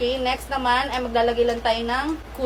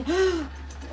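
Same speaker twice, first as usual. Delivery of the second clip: high, sharp breath in; demonstrative inhaled gasp; pretend belly-tucking gasp; 7 semitones higher, 4 dB quieter than usual. Shown as a demonstration. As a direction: suck in air as if holding the stomach in. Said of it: "kunwari hindi kumain".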